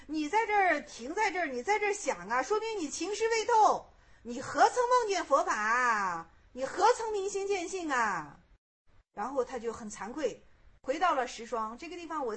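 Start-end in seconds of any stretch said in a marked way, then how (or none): 0.1-8.4 raised, high-pitched storyteller's shout of rebuke; loud rebuke. A narrator's reading. 善逝者 scolding the monk who stops to think, loud rebuke. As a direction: bellow it as a reproach, drawing out the questions. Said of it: "善逝者就大喝他".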